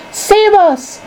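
high-pitched plea for help; panicked cry. A narrator's reading. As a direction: wail it as if doomed. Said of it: "cried Grandma Josephine".

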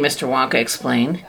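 ordinary delivery, nothing marked

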